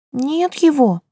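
put-on high, sighing little girl voice; a little girl sighing sadly. A narrator's reading. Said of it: "с грустью вздыхает Рапунцель".

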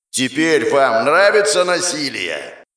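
slow, menacing monster voice; demonic voice. Acted. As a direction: loom over them, slowly. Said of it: "Демонический голос достигает каждой головы".

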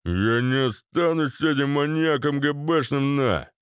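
put-on thug voice, mock yelling. A narrator's reading. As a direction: bawl the refusal with stretched vowels. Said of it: "заорал Вован".

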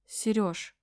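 strangled, soft voice catching; words squeezed out through a spasm; choking. A narrator's reading. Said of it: "сдавленно проговорила".

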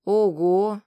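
put-on loud childlike wow; gasping little girls' wow; surprised. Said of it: "синхронно удивились близняшки".

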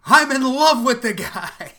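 mock lovestruck line, high and loud with a laugh; laughing quote. Podcast host quoting the anthropologist, laughing high and loud. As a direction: blurt it out laughing like a smitten anthropologist.

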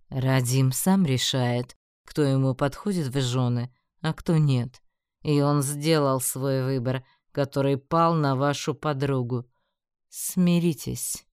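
slow, emphatic gloating; predatory. A narrator's reading. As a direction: spell it out slowly, with menace. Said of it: "хищно оскалился начальник дворцовой стражи".

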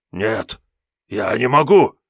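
a cowardly demon begging off; panicky little demon. Acted. Imp demon voiced in story. (визгливый испуганный демон)

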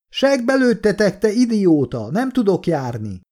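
high-pitched aggrieved shouting; indignant yell. A narrator's reading. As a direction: yell back in outrage at the shooter. Wounded man yells back at officer.

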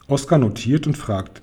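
ordinary delivery

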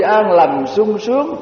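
ordinary talk

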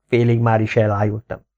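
usual speaking voice

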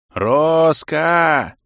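loud desperate yell. A narrator's reading. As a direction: shout the name with all your might. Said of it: "завопил я, что есть мочи".

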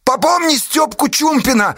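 high, strained cry; frantic high-pitched yell; desperate shout. A narrator's reading. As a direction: call after someone in desperation. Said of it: "отчаянно крикнул капитану Асташа".

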